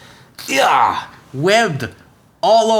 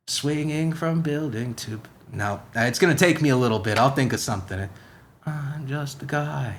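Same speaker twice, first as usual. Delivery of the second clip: put-on Spider-Man accent. Spider-Man musing to the listeners in a lightly put-on accent. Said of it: "Sometimes the accent shifts".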